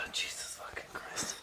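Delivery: sighed, whispered curse; exasperated whisper. Real, not acted. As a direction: breathe it out in despair.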